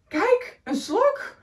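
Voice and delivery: put-on high excited child's cry; little Bob calling out. Little Bob spots the snail in class, excited. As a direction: point it out like a thrilled kid. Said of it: "riep kleine Bob".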